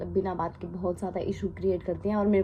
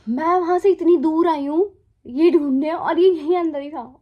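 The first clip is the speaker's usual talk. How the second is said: exasperated, higher and louder; frustrated outburst; 7 semitones higher, 9 dB louder than usual. Retold or imitated. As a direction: vent the annoyance of searching for nothing. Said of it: "मेरे को इतना गुस्सा आया".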